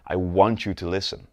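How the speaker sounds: emphasis on "want"; stress on WANT. Shown as a demonstration. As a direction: punch the word "want", then ease off. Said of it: "I put the emphasis on want".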